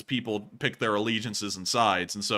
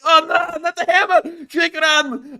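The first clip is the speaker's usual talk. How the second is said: very high, loud mock shriek of panic; panicked mock soldiers; 10 dB louder, slower than usual. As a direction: squeal it like a terrified soldier being pinned down. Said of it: "it's like".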